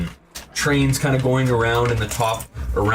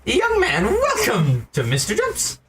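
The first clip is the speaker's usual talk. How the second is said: high, slow squeaky shopkeeper; a very high, bouncy insect merchant; grasshopper shopkeeper; 11 semitones higher, slower than usual. Acted.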